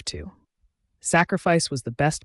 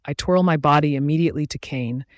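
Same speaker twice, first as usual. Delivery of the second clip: plain reading voice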